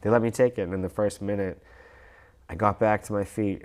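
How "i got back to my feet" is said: ordinary delivery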